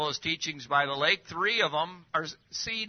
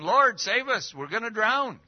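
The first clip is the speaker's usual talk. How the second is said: higher and louder, alarmed plea; panicky cry for help; 7 semitones higher, 4 dB louder than usual. Acted. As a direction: cry out like sailors in a sinking boat. Frightened disciples cry out in storm.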